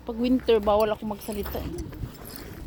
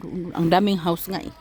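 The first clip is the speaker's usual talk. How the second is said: normal talking voice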